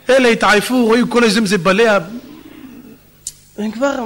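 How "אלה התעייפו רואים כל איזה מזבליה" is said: ordinary talk, nothing marked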